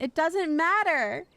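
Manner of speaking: put-on rising whine of protest over the accent; building whiny protest. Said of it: "so I can better judge the accent".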